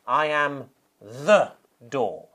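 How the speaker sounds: slow, with "the" stretched out and set apart; stress on THE. Shown as a demonstration. Preacher shows the stress in Jesus' words, "the" drawn out and emphasised. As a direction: lean hard on "the" before "door". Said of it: "quite a stress on the word the".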